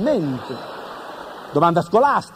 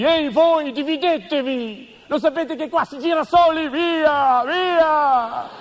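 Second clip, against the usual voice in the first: high, slow chasing shout; shouting guard; 7 semitones higher, slower than usual. Retold or imitated. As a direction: yell them out of the field, hammed-up. (urlo da guardiano, alto e lento)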